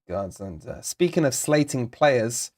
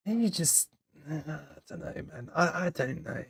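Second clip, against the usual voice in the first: much softer, trailing off; defeated mumble; 11 dB quieter than usual. Real, not acted. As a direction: mumble it as if giving up. Lost for words rating player.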